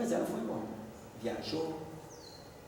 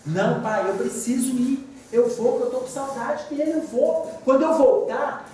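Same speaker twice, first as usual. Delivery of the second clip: higher pleading daughter voice; 7 semitones higher than usual. Retold or imitated. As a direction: play the stubborn daughter begging to go. Daughter insists to father.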